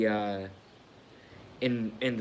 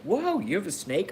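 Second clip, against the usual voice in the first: surprised neighbor; 8 semitones higher than usual. Retold or imitated. Neighbors voiced in retold scene.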